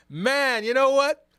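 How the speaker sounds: high, loud burst of protest; animated retort. Real, not acted. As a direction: jump in loudly to argue back. Animated retort to viewer's Superman joke.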